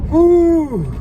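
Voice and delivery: high, loud whew; cold whew. Real, not acted. Cold exclamation.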